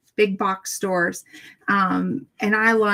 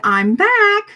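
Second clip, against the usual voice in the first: cheery call; 11 semitones higher, 6 dB louder than usual. Acted. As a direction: sing out a bright, much higher and louder hello. Greeting the shop lady again.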